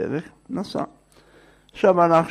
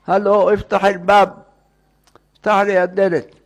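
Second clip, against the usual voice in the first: raised, higher storyteller's shout; calling out; 5 semitones higher, 4 dB louder than usual. Retold or imitated. Man shouting to the baker to open up, calling out. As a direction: call through a locked door, pitched up. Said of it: "אז צועק לו".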